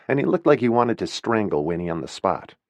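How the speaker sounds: ordinary delivery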